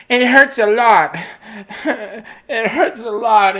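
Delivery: high, slow, laughing whimper; laughing whine. Acted. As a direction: whine it while cracking up. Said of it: "he started to cry".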